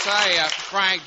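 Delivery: loud, high, slow greeting; hearty opener. Acted. [name] addressing Frank on air, hearty. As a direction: kick off the banter with gusto. Playful start with host banter.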